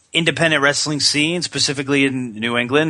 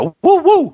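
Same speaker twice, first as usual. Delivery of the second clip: loud, very high double whoop; wrestler's signature falsetto-high woo; Ric Flair whoop; 8 dB louder than usual. Retold or imitated. Host quoting wrestler's parting cry, loud high whoops. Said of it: "followed by a".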